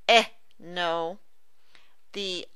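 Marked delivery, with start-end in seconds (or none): 0.1-0.2 "eh" as an isolated short E vowel; short E sound. Shown as a demonstration. Teacher demonstrating the short E sound, "eh" said alone. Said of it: "The E".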